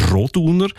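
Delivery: "Roduner" sounded out tentatively, pitched high; tentative name stress. Shown as a demonstration. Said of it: "Wie betont man den richtig?".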